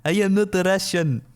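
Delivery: hammed-up Russian denial; fake Russian accent. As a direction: put on a bad Russian accent. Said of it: "fake a Russian accent".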